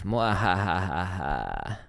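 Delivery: slow drawn-out villain cackle; evil laugh. Pretend. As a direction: cackle slowly like a cartoon villain. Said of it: "like, do an evil laugh".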